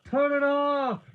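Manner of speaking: very high, loud, desperate scream; agonized full-voiced plea. A narrator's reading. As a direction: scream it like someone trapped in a machine. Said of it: "Stanner screamed".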